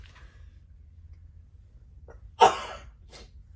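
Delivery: muffled real sneeze turned away. Real, not acted. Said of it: "I looked away".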